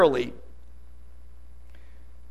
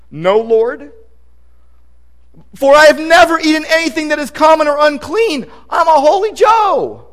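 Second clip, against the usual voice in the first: louder, higher prissy protest; mock self-righteous protest; 6 semitones higher, 5 dB louder than usual. Peter protests to God, hammed-up and self-righteous. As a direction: play Peter as a prim holy Joe.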